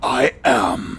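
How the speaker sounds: superhero voice. Acted. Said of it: "That's my superhero voice".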